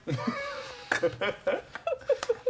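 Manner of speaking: high shrieking laugh. Real, not acted. Laughing after joke.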